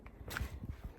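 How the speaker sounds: soft, airy little laugh; breathless chuckle. Real, not acted. Hiker laughing after the steep climb, winded chuckle. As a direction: laugh quietly on spent breath.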